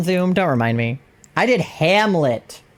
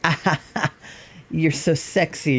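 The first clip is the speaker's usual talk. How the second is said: douchebag guy; 4 dB quieter than usual. Shown as a demonstration. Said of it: "douchebaggery, like, masculine guy".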